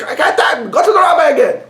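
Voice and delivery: high, loud fed-up cry; exasperated shout. Acted. Husband shouting at wife to go back to the rabbi. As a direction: yell it out, at the end of his rope.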